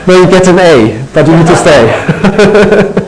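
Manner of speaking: slow, laughing tease; drawn-out joke through laughter. Real, not acted. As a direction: tease them while chuckling. Teacher jokes with laughing class.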